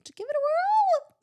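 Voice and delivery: very high, loud drawn-out squeal. Shown as a demonstration. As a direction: sing it out way up high, slowly.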